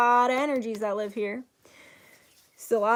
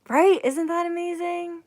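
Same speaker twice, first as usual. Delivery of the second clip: excited gush; 7 semitones higher, 4 dB louder than usual. Real, not acted.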